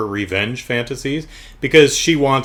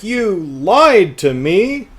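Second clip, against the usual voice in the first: drawn-out high, wailing indignation; mock accusation; 8 semitones higher, 4 dB louder, much slower than usual. Wronged woman confronting the producer, mock accusation. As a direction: stretch it out in hammed-up outrage. Acted quarrel, she confronts him.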